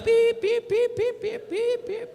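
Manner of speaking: high, sharp whistle blasts; shrill mouth whistle like a traffic cop; traffic whistle imitation. Believer turned traffic cop, whistle imitation. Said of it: "virava guarda de trânsito".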